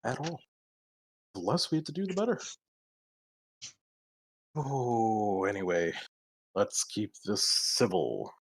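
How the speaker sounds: soft, distant ghostly voice; voice from the ether. Acted. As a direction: call faintly from far away. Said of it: "a voice from the ether".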